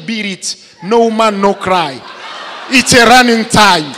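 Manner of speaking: playing a schoolboy faking English, loud and laughing; mock English. Schoolboy showing off fake English to friends, loud. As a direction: belt out fake English song titles. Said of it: "quand je te parle comme ça".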